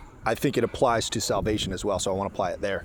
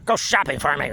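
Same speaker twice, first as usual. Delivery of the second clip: mock yelling at wife; 7 semitones higher than usual. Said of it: "yelling at his wife".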